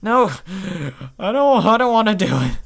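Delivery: high, loud desperate refusal; terrified begging shout; panicked pleading. Acted. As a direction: beg them to stop. Pleading under torture.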